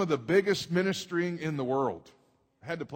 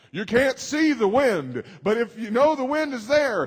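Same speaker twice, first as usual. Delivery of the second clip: higher, louder, building preacher's cry; preaching climax; 9 semitones higher, 7 dB louder than usual. Acted. Pastor driving home faith to the congregation, rising preaching. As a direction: rise into full-force preaching.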